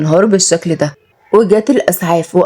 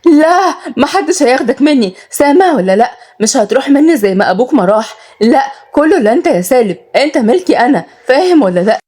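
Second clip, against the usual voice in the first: higher, frenzied cry of a possessive mother; cling to your son, half mad; frantic; 6 semitones higher than usual. A narrator's reading. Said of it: "وردت شهيرة بجنون". (أم مهووسة بتصرخ بجنون وبصوت أعلى)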